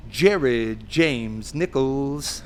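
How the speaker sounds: long, drawn-out showman's name call; announcer introduction. Retold or imitated. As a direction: stretch every syllable like a stage announcer. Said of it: "And he goes".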